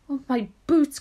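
loud, high exclamation of dismay; high, loud storybook lament; dismayed cry. Acted. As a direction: cry out over a precious loss. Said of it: "cried the centipede".

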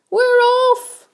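very high-pitched echoing cheer; excited cry. A narrator's reading. Others echo the centipede, high excited cry. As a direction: squeal it like an excited crowd. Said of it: "the others cried".

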